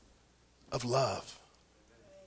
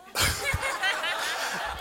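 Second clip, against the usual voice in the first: high-pitched burst of laughter; laughing. Real, not acted. Preacher cracks up at his son's warning, high laughter.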